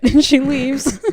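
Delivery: high, loud words breaking into laughter; laughing. Real, not acted. Telling how Arya storms off, really laughing. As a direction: crack up while saying it, slow and loud.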